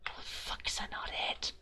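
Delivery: whispered swearing in disbelief; frustrated whisper. Real, not acted. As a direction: hiss it under your breath. Frustrated muttering at puzzle.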